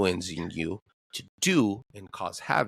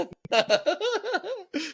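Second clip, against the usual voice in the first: high-pitched burst of laughter; real high cackle. Real, not acted. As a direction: crack up at the story. Reacts to funny story.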